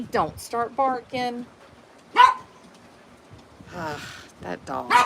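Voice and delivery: usual speaking voice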